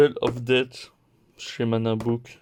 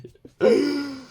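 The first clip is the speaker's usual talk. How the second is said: high, bright burst of laughter; short, high giggle; 4 dB louder than usual. Real, not acted. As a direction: laugh at her own rant. Laughs after her own complaint.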